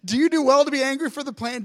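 quick, offhand retelling of God's question; fast casual paraphrase. Shown as a demonstration. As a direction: toss it off like a friend asking. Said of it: "This is the best. Like".

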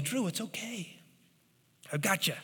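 soft, hushed comfort; calm a scared kid up close; reassuring leader. Retold or imitated. Leader pulls frightened Drew aside, soft and hushed.

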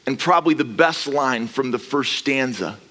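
ordinary delivery

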